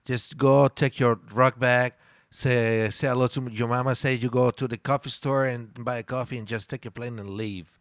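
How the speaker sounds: normal talking voice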